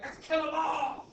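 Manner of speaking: high, loud gung-ho yell; mock war cry. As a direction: shout it like a frenzied crowd. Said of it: "Americans were like".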